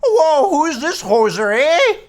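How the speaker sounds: a hammed-up Canadian judge, half laughing. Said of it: "he's Canadian".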